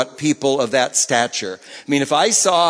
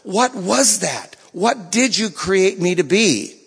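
higher, slow, searching voice; pleading prayer; 6 semitones higher, slower than usual. Retold or imitated. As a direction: ask God slowly, like a plea. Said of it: "and he says".

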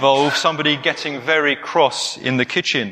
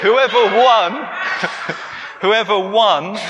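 laughing through the words; 7 semitones higher, slower than usual. Real, not acted. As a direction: giggle while saying it. Laughing at own story.

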